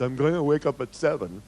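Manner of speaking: loud, punched-out short resolution; bare resolution. Shown as a demonstration. Speaker showing what not just to tell yourself, bare resolution. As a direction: state it loudly and stop at seven. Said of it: "not just".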